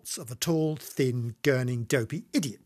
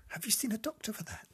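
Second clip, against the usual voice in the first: hushed whisper, appalled; a hushed, horrified aside; 8 semitones higher, 7 dB quieter, faster than usual. Acted. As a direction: whisper it so Doreen alone hears.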